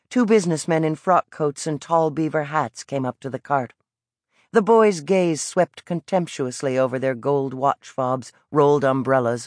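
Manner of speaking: ordinary delivery, nothing marked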